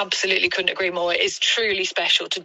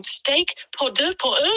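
slow, higher-pitched French question; imitating a waitress, pitched up in French; querying waitress; 8 semitones higher than usual. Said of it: "the waitress was going".

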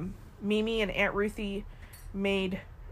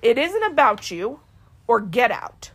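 slow, louder stern command, the last words hissed; firm scolding; 5 semitones higher, 6 dB louder than usual. Retold or imitated. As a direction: lay down the law to the weeping family. Doctor scolding crying relatives.

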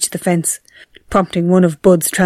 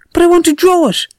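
high, loud whining protest; pleading protest; 12 semitones higher, 4 dB louder than usual. A narrator's reading. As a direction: beg to keep the bird. Boy protests to brother.

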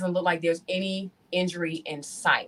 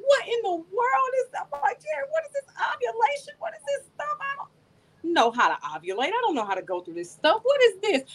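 much higher, squealing mock panic; freaking out. Asking her friend Jerry about ovulation, high freaked-out squeal. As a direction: shriek questions like a lost kid. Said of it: "I'm all freaking out".

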